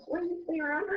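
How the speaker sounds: put-on high-pitched, shy Southern accent; timid question in a high Southern accent. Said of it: "has to speak like little Southern accent".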